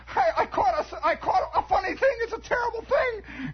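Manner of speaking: very high, stammering alarm; acted kid in a fright; panicked boy. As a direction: blurt it out in a high frantic cry. Panicked boy reporting his catch.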